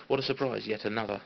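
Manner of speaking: ordinary talk, nothing marked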